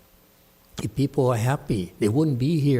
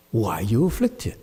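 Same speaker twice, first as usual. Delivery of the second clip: ordinary talk, nothing marked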